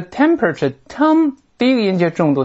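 teacher isolating the stressed "tem" of "temperature"; stress on first syllable. Shown as a demonstration. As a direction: hit TEM hard in "temperature".

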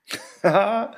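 bright squeaky giggle; a little mouse laughing it off; mouse's laugh. Acted. Mouse laughing at the snake. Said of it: "Lachte de muis".

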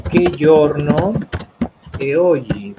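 loud, slow, drawn-out teacher's question; stretched out and emphatic, pausing midway; slow modelling. Shown as a demonstration. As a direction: project it word by word for a learner.

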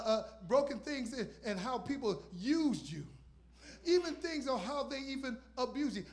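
loud driving sermon cadence; preaching at full force. Acted. Preacher testifying to the congregation, building fervour. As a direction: preach it out with fire.

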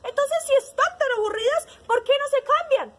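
high, loud parrot voice; advising parrot. A narrator's reading. Parrot telling sheep and hen to swap, emphatic. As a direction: propose it like a bossy storybook parrot. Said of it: "Entonces el lorito le dijo".

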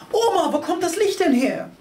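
put-on high, eager child voice; child calling to his grandma, high and curious; child asking grandma. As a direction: pipe up like a curious little kid. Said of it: "Ich frag".

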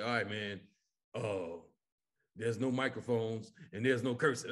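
low, subdued voice of the comic friend; downbeat relayed warning. Retold or imitated. Comic friend passes on the show's rules, low and subdued. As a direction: break the rules gently, low and quiet.

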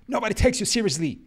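loud, high, fast heckle; mock angry retort. Critic shooting down the apology, loud mock outrage.

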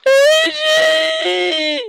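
very high, hammed-up wailing shriek; playful high-pitched tearful shout; mock anguished cry. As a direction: wail the name in fake heartbreak.

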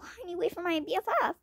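fast hushed whisper. Acted. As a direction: whisper quickly so nobody hears. Kid whispering in hallway.

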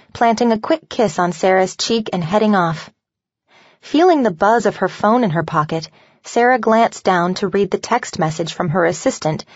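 ordinary delivery, nothing marked

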